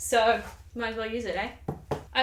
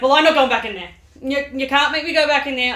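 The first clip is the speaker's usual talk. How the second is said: loud mock indignant refusal; refusing Macbeth; 3 semitones higher, 8 dB louder than usual. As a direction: dig your heels in, protesting. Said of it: "And Macbeth's like".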